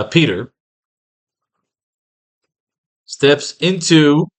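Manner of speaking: slow, halting, word by word as if typing it out; slow dictation with long gaps between phrases; dictating slowly. Real, not acted. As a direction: spell out each phrase while writing. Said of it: "All right, so let's do this".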